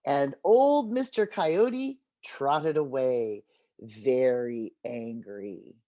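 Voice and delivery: slow, winding-down storybook close; slow ending. A narrator's reading. Teacher closes the story for the kids, slow. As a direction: draw out the last line.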